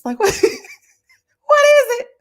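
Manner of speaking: high, loud question through laughter; laughing disbelief. Real, not acted. Host joking about kids stuck home in snow, laughing disbelief. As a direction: crack up while asking.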